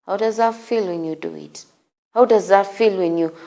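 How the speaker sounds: ordinary talk, nothing marked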